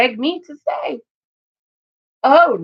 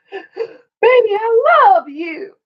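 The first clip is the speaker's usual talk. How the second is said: pleading husband; 11 semitones higher, 4 dB louder, slower than usual. Retold or imitated. Husband begs wife.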